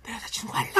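high breathy whisper, half laughing; cupped hand whisper. Retold or imitated. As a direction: murmur it into an ear. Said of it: "귀에 대고".

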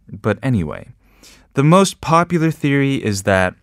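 ordinary delivery

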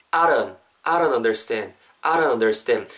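"I don't" run together as "a-run"; flapped "don't". Shown as a demonstration. Teacher showing learners the second way, "I don't" as "a-run". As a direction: soften the d of "don't" into a tapped r. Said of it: "우리말의 리을로 발음된다라고 생각하시면".

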